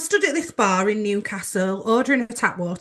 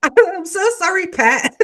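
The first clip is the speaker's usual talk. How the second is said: high, loud mock Geordie apology; Geordie accent; 8 semitones higher, 5 dB louder, slower than usual. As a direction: put on Geordie through a laugh. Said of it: "I'm not gonna do a Geordie accent again".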